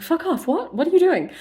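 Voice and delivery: high, indignant disbelief; shocked outburst. Acted. Feyre recoiling from Rhys licking her face, high-pitched shock.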